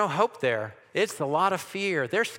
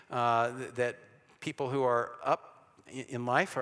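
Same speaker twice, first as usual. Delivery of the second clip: ordinary delivery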